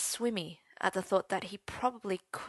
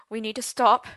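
urgent plea; 3 semitones higher, 10 dB louder than usual. Acted. Merlin breaking off.

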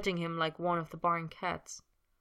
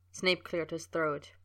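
plain reading voice

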